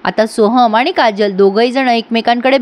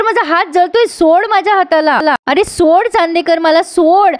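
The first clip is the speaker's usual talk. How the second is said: high, pleading mock yell; imitating her yelling in pain; retold yelling; 6 semitones higher than usual. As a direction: cry out to be let go, half-acted. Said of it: "ओरडू नको".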